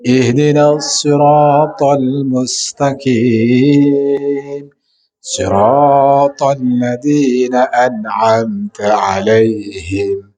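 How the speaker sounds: slow melodic Quranic chant; Quran recitation. Acted. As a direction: draw out each verse in tarannum.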